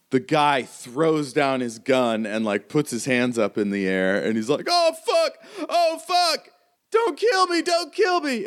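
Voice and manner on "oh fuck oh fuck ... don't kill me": high-pitched, terrified pleading; panicked begging. Acted. Gunman with hands up begs for his life, panicked high cry.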